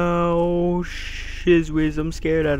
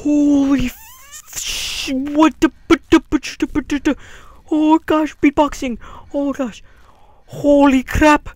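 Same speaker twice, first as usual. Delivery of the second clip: high startled gasping words; shocked; 10 semitones higher than usual. Real, not acted. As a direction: stammer in fright. Shocked after jump scare.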